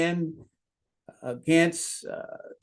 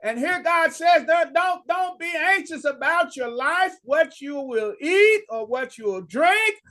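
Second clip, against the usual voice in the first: high, ringing sermon voice; preaching at full force; 12 semitones higher than usual. Real, not acted. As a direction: drive the verse home from the pulpit.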